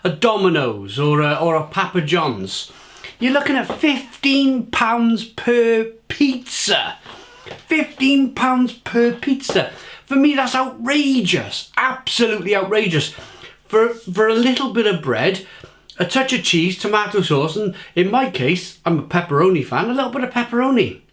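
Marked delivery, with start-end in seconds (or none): 3.2-9.7 slow, high, incredulous; outraged disbelief. Real, not acted. Outraged at takeaway prices. 10.0-21.0 indignant rant. Real, not acted. Ranting about pizza prices.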